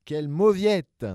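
slow, high, loud taunting jeer; mocking. Siblings taunting their little brother, slow loud jeer. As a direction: drag it out to sneer at the weakling. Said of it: "se moquèrent Abominable et Affreuse".

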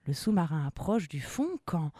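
plain reading voice

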